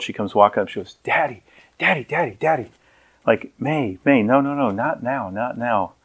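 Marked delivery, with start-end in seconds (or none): 1.0-2.7 put-on soft, higher-pitched child pestering Dad; little daughter. Five-year-old tugging at her preacher dad onstage, softly insistent. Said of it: "my little daughter, May, five years old". 3.6-5.9 hushed brush-off. Retold or imitated. Dad onstage hushing his little daughter, quietly. Father hushing child on stage.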